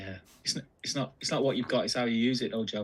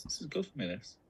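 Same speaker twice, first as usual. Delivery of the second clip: whispering; 10 dB quieter than usual. Real, not acted. Muttering to himself while searching.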